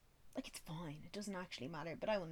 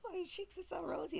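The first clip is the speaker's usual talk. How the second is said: high, sugary mock coo; 11 semitones higher than usual. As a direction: mimic the cooing colleagues. Mocking coworkers' comments.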